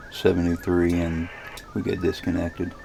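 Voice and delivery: ordinary talk, nothing marked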